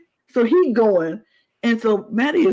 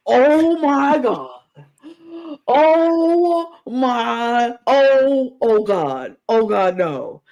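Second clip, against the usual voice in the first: slow, higher dismayed gasp; mock horror; 6 semitones higher, much slower than usual. Acting out the tribe finding the cage empty, slow dismay. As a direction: draw it out in growing dread.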